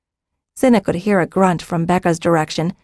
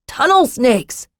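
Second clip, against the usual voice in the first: higher-pitched cry of warning; 7 semitones higher, slower than usual. A narrator's reading. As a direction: cry the alarm, without full volume. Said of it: "Jakaran cried".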